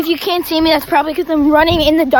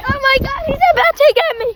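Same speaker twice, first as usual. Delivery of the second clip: being chased; 10 semitones higher, 4 dB louder than usual. Pretend. Kid chasing him at campfire.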